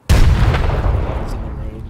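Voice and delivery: loud callout. Real, not acted. Over grenade noise.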